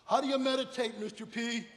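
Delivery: high, loud imitated question; questioning veterans. Young veterans ask their teacher, loud mock questioning. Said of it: "They go".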